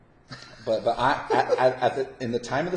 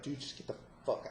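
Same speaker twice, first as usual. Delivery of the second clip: angry whisper; 3 semitones higher, 10 dB quieter than usual. Retold or imitated. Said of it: "but I was so mad".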